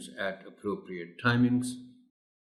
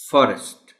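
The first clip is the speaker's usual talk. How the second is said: forest। said louder with stress on FOR; 3 semitones higher, 7 dB louder than usual. Shown as a demonstration. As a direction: punch the first syllable of forest।. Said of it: "The correct word is".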